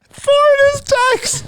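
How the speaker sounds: high, squeaky laughing shout; words squeezed through helpless laughter. Real, not acted. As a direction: crack up while blurting it out. Laughing repeat of a mishearing joke.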